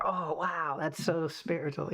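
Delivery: mock being deeply moved; mock awe. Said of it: "I was like".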